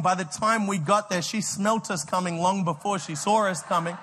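ordinary delivery

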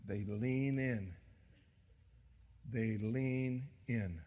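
slow soft emphasis. Real, not acted.

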